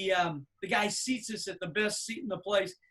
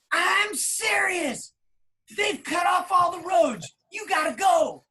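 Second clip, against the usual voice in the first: much higher, loud and slow, each word hammered; emphatic shout; 8 semitones higher, 5 dB louder, slower than usual. Retold or imitated. Manager phoning the band again, urgent slow shout. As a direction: demand it, dead serious.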